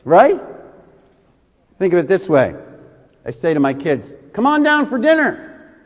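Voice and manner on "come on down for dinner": high-pitched dinner call; parent's singsong summons. Retold or imitated. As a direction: call up to the kids. Parent calling kids to dinner.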